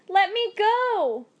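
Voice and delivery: high desperate cry, slow. A narrator's reading. Woman at the river crying to be freed, high and loud. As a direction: scream to be let go. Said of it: "she cried".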